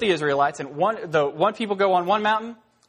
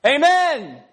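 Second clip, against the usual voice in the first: crowd shout; 10 semitones higher, 5 dB louder than usual. Acted. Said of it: "are gonna go".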